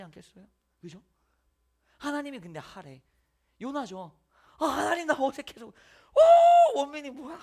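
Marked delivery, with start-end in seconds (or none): 4.6-5.7 loud, higher pleading whine; whiny plea. Acted. Jonah loudly whining to God that it's awkward. 5.7-7.4 squealed, much higher surprised cry; mock shock. The preacher squealing in mock shock at the congregation. Mock exclamation, huge pitch jump.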